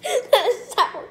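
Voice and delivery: high, loud laughing through the words. Real, not acted. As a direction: laugh while you say it. Laughing after biting a lemon.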